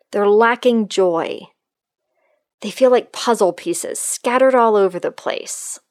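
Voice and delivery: normal talking voice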